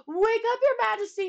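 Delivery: high, loud urgent shout. Acted. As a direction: yell to wake the king. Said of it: "he shouted".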